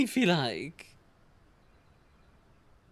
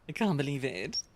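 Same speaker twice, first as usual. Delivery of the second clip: usual speaking voice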